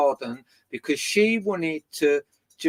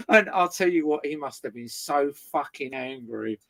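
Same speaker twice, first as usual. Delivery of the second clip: usual speaking voice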